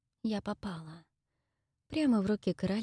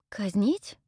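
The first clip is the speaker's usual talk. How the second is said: surprised echo; 3 semitones higher than usual. Acted. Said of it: "переспросила я".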